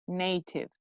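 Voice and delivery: "Native" softly, with a long ay; correct pronunciation. Shown as a demonstration. Teacher reminding class of the phonemes, correct pronunciation. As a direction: say "Native" as NAY-tiv. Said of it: "How do you pronounce the A".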